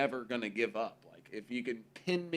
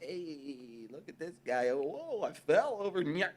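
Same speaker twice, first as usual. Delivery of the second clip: high, silly clowning; clowning kid; 5 semitones higher than usual. Retold or imitated. Kid clowning to distract angry parents, silly taunt. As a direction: ham it up like a kid falling over. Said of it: "I was like".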